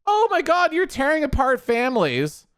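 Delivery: high-pitched hammed-up indignation; mock outrage. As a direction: imitate a melodramatic protester. Mimicking outraged deportation critics.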